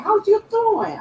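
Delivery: high, bright-eyed northern English hello to a friend; northern accent. Pretend. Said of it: "I'm doing, like, a northern accent".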